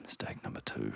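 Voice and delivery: hushed deadpan whisper; dry whispered aside. Real, not acted. Mother telling her story, a whispered wry aside.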